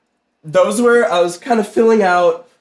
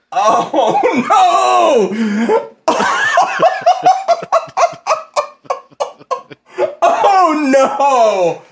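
relieved laughter; 9 semitones higher, much slower than usual. Real, not acted. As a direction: crack up helplessly. Laughing at drawing mistake.

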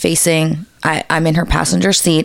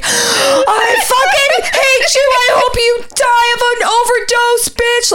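high, drawn-out hysterical screech; mock tearful shriek; imitated screaming meltdown; slower than usual. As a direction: mimic a friend screaming her head off. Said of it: "she's losing it".

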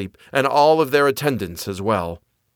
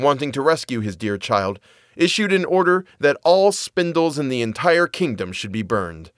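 usual speaking voice

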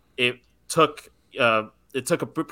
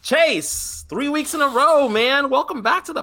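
bright, much higher welcome; excited greeting; 14 semitones higher than usual. Real, not acted. Host welcoming the co-host back, excited and pitched high. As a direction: hype up the returning guest, pitched way up.

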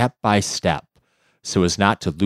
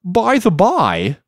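jellyfish voice; 12 semitones higher, 5 dB louder, slower than usual. Acted. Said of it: "said the jellyfish".

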